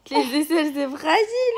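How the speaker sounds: high, loud, teasing laugh. Real, not acted. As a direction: tease through laughter. Teasing while tickling, laugh.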